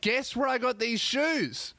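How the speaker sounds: high, drawn-out teasing; smug teaser. Pretend. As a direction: tease it out slowly. Acting the annoying guesser.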